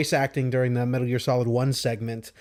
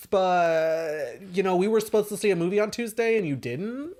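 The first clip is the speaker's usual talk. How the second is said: mocking nagging girlfriend; 5 semitones higher, faster than usual.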